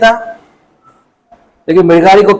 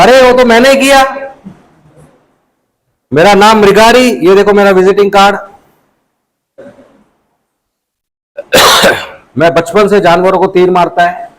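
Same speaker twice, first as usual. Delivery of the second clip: a hunter showing off his kills to the sage; proud boastful hunter; 3 semitones higher than usual. Acted. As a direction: boast it like a man expecting a prize. Hunter proudly claims the killing.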